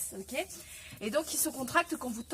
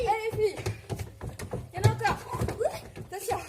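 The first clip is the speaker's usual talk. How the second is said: calling out; 6 semitones higher, much faster than usual. Real, not acted. Coach calling out during cardio.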